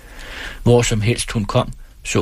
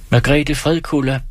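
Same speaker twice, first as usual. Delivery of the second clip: ordinary delivery, nothing marked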